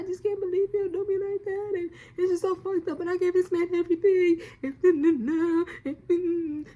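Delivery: high, wailing sob-talk; high crying plea, stammering and. Retold or imitated. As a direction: wail it out through tears, voice breaking. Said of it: "I'm sitting there crying".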